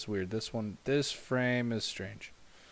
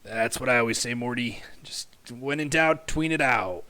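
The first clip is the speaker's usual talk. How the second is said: bad Rick impression; 7 dB louder than usual. Streamer doing a bad Rick impression telling Morty to tween. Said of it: "That was a really bad impersonation".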